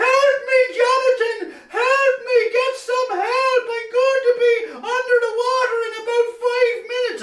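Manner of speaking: very high, tearful squeaky cry for help; high, panicky rabbit voice pleading from the river; frightened Easter Bunny. Acted. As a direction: squeal like a tiny animal stuck on a rock. Stranded bunny cries for help.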